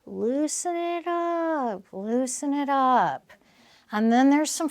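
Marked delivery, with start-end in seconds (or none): 0.0-3.3 slow, soft, drawn out; slow soothing. Shown as a demonstration. Speaker guiding the audience to relax, slow. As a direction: let it loosen gently. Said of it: "demonstrates loosening".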